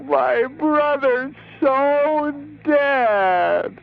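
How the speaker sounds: very slow, high wail; hammed-up drawn-out lament; wailing grief. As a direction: howl the grief, stretching each word out. Caller wails about dead brother.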